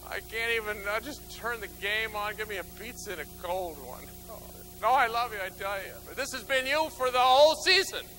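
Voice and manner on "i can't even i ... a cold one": exhausted parent. Acted. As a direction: dread the game night, worn out. Tired parent refusing kids.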